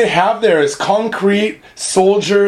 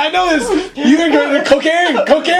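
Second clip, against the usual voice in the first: excited; 8 semitones higher than usual. Real, not acted. Excited high voiced recall.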